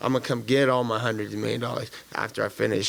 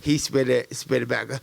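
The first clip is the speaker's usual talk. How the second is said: normal talking voice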